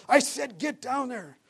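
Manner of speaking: high, drawn-out Marine order; mock drill yell. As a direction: imitate a sergeant yelling an order, slowly. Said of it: "just yelling and screaming".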